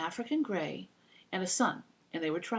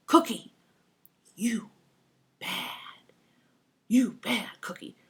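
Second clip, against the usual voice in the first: mock scolding; 4 semitones higher, 5 dB louder, slower than usual. Scolding the biting bird.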